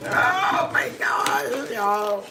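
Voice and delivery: wheezing, silent-laugh "oh my gosh"; helpless breathy laughter; breathless laugh. Real, not acted. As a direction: gasp it out while laughing. Cracking up at reaction video.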